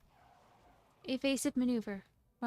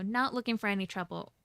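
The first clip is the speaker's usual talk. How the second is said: usual speaking voice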